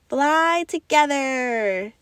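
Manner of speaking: the pilot calls his crew; child pilot. A narrator's reading. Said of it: "said the pilot".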